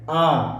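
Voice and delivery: आ held a little longer; lengthened demonstration of आ; long vowel. Shown as a demonstration. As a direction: stretch आ beyond a short अ. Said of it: "तनिकुन बागरा समय लागत है".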